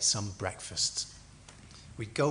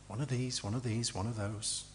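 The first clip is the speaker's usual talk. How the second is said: quiet, low, listing mumble; offhand shopper; 3 semitones lower, 7 dB quieter than usual. Acted. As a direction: reel off items casually, like shopping. Mimics careless shopping-list prayer.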